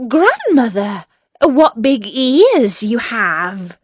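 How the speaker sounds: put-on a girl marvelling at grandmother, slow; lightly voiced amazed girl; amazed little girl.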